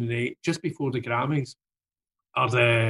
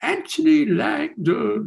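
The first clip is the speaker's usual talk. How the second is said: high, slow, drawn-out name; mock grand self-introduction; 12 semitones higher, 4 dB louder, slower than usual. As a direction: announce yourself like a pop star, stretching the name. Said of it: "Anthony goes".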